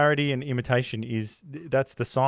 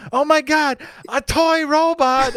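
shrieking mock panic, much higher and louder, slow on toy robot; mock terror; 14 semitones higher, 6 dB louder, slower than usual. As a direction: scream it like a terrified henchman. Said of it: "the guy goes".

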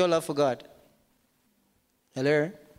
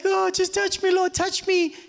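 mock pleading prayer; 10 semitones higher than usual. Preacher mocking a needy believer's prayer, high and whiny. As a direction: ham up a needy worshipper's plea.

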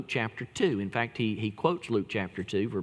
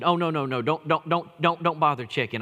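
put-on panicked boy; 5 semitones higher, 3 dB louder than usual. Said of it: "the boy said".

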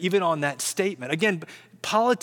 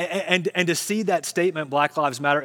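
usual speaking voice

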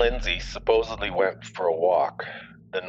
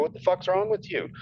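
retold angry outburst; 9 semitones higher than usual. Retold angry call to police.

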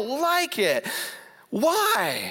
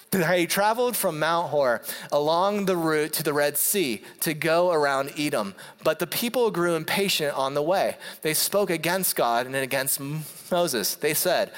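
steady, lower-pitched Bible reading; scripture reading; 5 semitones lower than usual. Acted. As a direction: read the passage evenly, like from the pulpit. Reads scripture passage.

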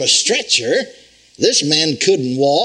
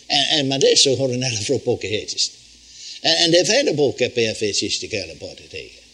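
fluent glossolalia, lower and flowing; speaking in tongues; 4 semitones lower than usual. Shown as a demonstration. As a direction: pour out tongues as in prayer. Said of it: "speak with tongue".

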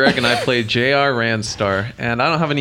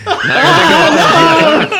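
slow, loud, high gleeful shout; laughing shout; 7 dB louder than usual. Real, not acted. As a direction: crow it out while laughing. Teasing the group, laughing.

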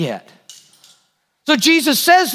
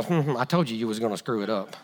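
mock told-you-so; 12 dB quieter, faster than usual. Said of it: "Jesus just look at me and go".

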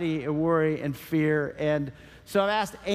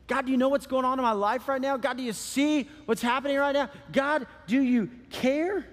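higher, insistent questioning of God; acted pleading prayer; 6 semitones higher than usual. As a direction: plead upward, slowing on the last question. Voicing a believer's prayer in crisis.

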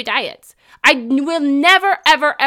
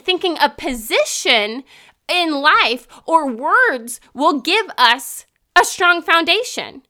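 ordinary talk

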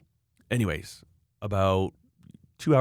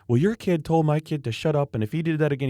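higher, indignant retold complaint; complaining mother; 7 semitones higher than usual. Mother confronting the other kid's parent, indignant. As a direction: imitate a parent lodging a grievance.

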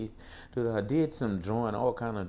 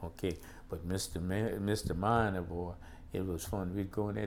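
ordinary delivery

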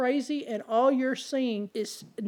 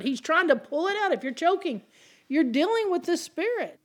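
building, higher-pitched preaching that climbs toward the question; rising preaching fervor; 6 semitones higher, 3 dB louder than usual. Acted. Preacher repeating his question to the congregation, fervent. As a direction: press the congregation harder and higher.

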